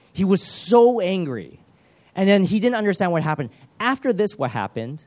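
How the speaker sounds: ordinary delivery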